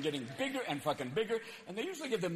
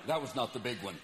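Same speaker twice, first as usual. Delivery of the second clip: deadpan expert; 8 semitones lower than usual. Retold or imitated. An earthquake expert flatly dismissing the fear. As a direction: calmly dismiss the worry. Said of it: "And they went".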